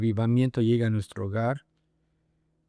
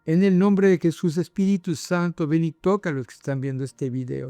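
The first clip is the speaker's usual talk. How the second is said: raised, invoking prayer voice; 5 semitones higher than usual. Real, not acted. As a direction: pray earnestly over those watching. Prays over the viewers. (oración de invocación con voz elevada)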